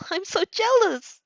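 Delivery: high, slow little whine of envy; shy envy. Acted. Shy Uraraka admitting envy, high and slow. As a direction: sigh it out high and wistful. Uraraka envies bold friends.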